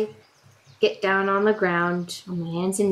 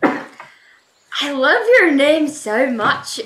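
loud, higher, drawn-out gush; gushing compliment; 4 semitones higher, 7 dB louder, slower than usual. Acted. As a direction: slowly heap on the praise.

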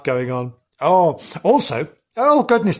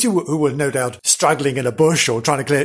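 ordinary talk

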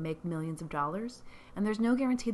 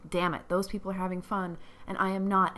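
ordinary delivery

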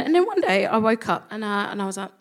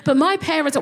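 pitched up, eager hello; nervous hello to women at the bar; nervous high greeting; 6 semitones higher, 4 dB louder than usual. Retold or imitated. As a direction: overeager nervous newcomer. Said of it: "And I was like".

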